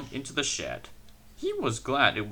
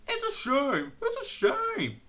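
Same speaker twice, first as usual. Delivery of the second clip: much higher indignant cry; hissed. A narrator's reading. Henry protests the vandal boys, high indignant cry. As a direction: protest bitterly. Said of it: "hissed Henry".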